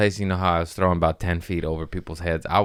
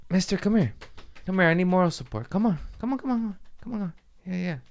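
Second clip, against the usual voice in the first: coaxing call; 11 semitones higher, 5 dB quieter, faster than usual. Real, not acted. Coaxing a pet or child, high.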